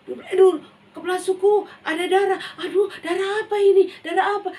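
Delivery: high, loud frightened cry; alarmed cry. Acted. Villager crying out to the chief at the blood. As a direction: gasp in alarm.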